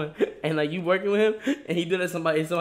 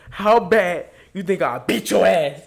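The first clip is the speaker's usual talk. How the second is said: slow, louder taunt; mock menacing threat; 3 semitones higher, 9 dB louder, slower than usual. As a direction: drag out the challenge. Retold prank threat in mall.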